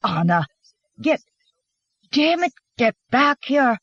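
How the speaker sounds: frustrated shout, breaking off then rising; sputter, then burst out with the order; angry swearing. A narrator's reading. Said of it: "swore Carl".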